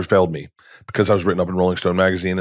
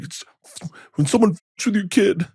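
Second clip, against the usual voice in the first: higher, choked-up voice of a father in tears; crying dad; 11 semitones higher than usual. Retold or imitated. Father tells his son why he is crying, higher and choked. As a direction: let it crack upward, like fighting tears. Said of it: "he started crying".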